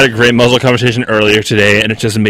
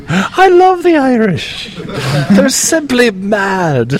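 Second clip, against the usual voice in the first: playful fake Irish lilt, pitched up; mock Irish accent; 10 semitones higher, much slower than usual. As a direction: put on a silly high Irish accent for a jab. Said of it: "Apologies to everyone in Ireland".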